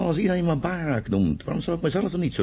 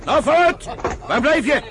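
calling out; 10 semitones higher than usual. Acted. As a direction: call him out of his tent. Calling Suleiman out of tent.

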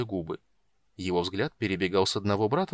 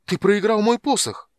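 much higher and louder, мой hit hard in dismay; a high, loud despairing outcry; horrified cry; 12 semitones higher, 8 dB louder than usual. A narrator's reading. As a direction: near fainting from the shock of the loss. Mage learns his staff is gone.

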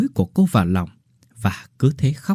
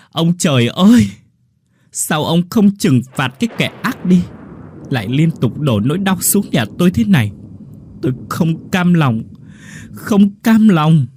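anguished wail; 7 semitones higher than usual. Acted. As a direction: howl your grief at the sky, drawing out the last words. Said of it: "gào lớn".

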